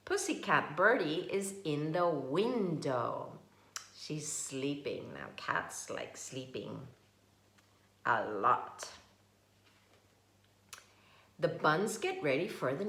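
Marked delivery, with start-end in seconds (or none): none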